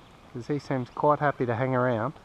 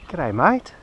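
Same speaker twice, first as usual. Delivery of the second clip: higher, friendly chat to a bird; pet talk; 7 semitones higher, 4 dB quieter than usual. Real, not acted. Man says g'day and chats to the kookaburra, higher pet-talk voice.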